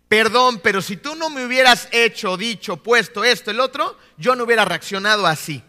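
loud, high-pitched blame posing as an apology; accusatory mock apology. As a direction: act out an apology that turns into an attack. Mimics an attacking, blaming apology. (disculpa fingida, acusadora, en voz alta y aguda)

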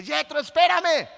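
slow, loud, high shout of "Jethro, espérame"; dad yelling at his son to wait, high and loud; retold yell to son. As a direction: call after a son racing ahead, full voice. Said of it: "le grité".

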